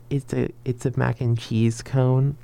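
slow, hesitant, mildly nervous retold answer; nervous, anxious. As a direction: stammer it out slowly, as if anxious. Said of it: "me being, like, nervous and, like, anxious".

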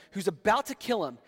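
ordinary delivery

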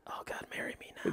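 barely-there lovestruck whisper; smitten whisper. Retold or imitated. Man retells falling for accent admirer, smitten whisper. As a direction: whisper it like a secret swoon. Smitten aside in retold story.

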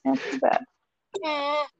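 slow, soft, drawn-out mock pity; mock sympathy. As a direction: stretch out a fake condolence. Mock sorrow repeated.

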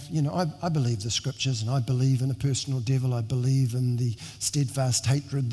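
ordinary delivery

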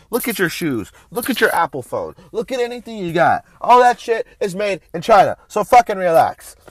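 fired-up, emphatic telling-off; scolding rant. Real, not acted. Ranting at people who swear off Chinese goods, scolding. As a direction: lay into them with punchy, rising emphasis.

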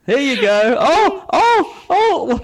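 very high, loud startled squawk; hammed-up falsetto panic; blanketed victim. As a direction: squeal in comic alarm as the lights vanish. Plays the woman under a blanket.

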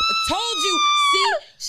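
sudden high shout, told you so; real shout. Real, not acted. To her cohost as the champagne spills over, a triumphant shout. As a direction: yell it as the cork blows.

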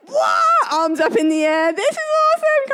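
high, fast, thrilled shout. Retold or imitated. Daughter on the roller coaster, squealing high with delight. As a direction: whoop it like a kid loving the ride. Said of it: "Skylar loved it. She was like".

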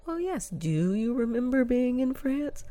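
high, leading mock-medium voice; charlatan medium. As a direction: play the fake psychic leading them on. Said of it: "asking leading questions".

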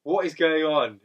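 slow, loud angry demand. Retold or imitated. Confronting the brother, slow loud outraged questioning.

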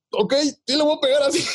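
very high, comic dad voice; imitating cowed dad. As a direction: squeak out a mock-obedient father, way up high. Acts out father just hit.

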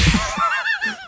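sharp high laugh; burst of laughter. Real, not acted. Sudden shock at the time.

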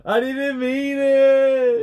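loud, high, drawn-out mock cry; hammed-up wailing apology. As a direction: wail it slowly, overdoing the remorse. Mock wailing apology for cracked egg.

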